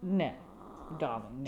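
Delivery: mock British refusal, dropping low; British accent. [name] refusing the pudding request, put-on British accent. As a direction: turn them down with a British "darling". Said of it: "was a British accent".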